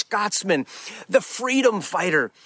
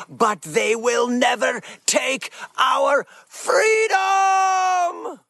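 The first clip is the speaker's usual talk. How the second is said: drawn-out, high battle cry of freedom; Scottish warrior's rallying shout; William Wallace; 6 semitones higher, much slower than usual. Acted. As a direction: build to a long shouted last word. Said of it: "Remember that great scene".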